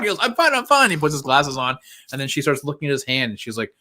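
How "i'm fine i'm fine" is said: high, hurried mock assurance; retold flustered insistence; acted hasty reassurance. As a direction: brush it off in a raised voice. Hero hastily reassures, acted.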